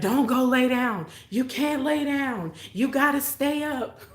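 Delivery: high, urgent pleading; panicked kids keeping her awake; frantic siblings. Retold or imitated.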